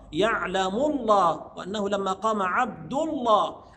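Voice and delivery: "الله" with a thick, dark l; heavy lam. Shown as a demonstration. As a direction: darken the doubled l of "الله". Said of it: "يسبقها ضم أو فتح تفخم". (تفخيم لام لفظ الجلالة في "الله")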